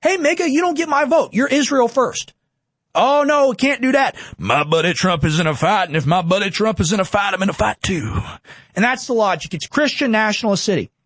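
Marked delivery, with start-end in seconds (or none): 0.0-2.3 defiant call-out. Pretend. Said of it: "by saying". 2.9-8.2 mock loyal supporter. Mocking loyal Trump supporter.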